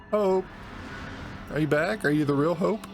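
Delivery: usual speaking voice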